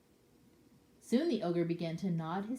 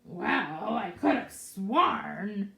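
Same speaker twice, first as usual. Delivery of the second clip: muttering ogre; 6 dB louder, much slower than usual. Acted. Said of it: "muttered the ogre".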